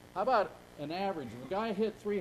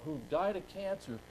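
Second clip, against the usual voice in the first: ordinary talk, nothing marked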